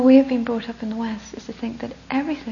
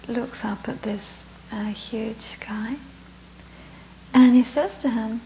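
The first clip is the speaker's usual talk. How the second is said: ordinary talk, nothing marked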